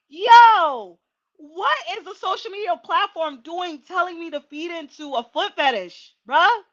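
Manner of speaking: high and loud, indignant disbelief; a real burst of outraged surprise; incredulous outburst. Real, not acted. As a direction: throw the question out in loud disbelief. Outraged at TikTok's suggestion.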